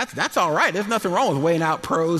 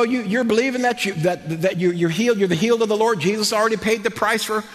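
normal talking voice

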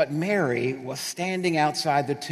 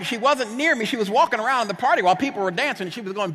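usual speaking voice

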